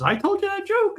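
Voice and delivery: very high surprised question; old Bob amazed he told the joke; surprised old friend. Retold or imitated. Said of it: "And he goes".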